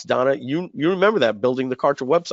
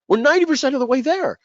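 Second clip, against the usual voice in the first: much higher, louder, almost cracking repeat; desperate pleading; 13 semitones higher, 3 dB louder than usual. Retold or imitated. Repeating to partners in desperation, much higher pleading. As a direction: push it up to a desperate peak. Begging partners not to restart.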